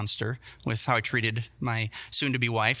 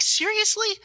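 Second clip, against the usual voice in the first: very high squeaky seriously; high, strained falsetto seriously; gleeful disbelief; 4 dB louder, slower than usual. Retold or imitated. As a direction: squeal it in delighted shock. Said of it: "I'm like".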